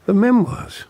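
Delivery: flustered outburst; agitated. Acted. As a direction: blurt it out, rattled. Father upset over his book.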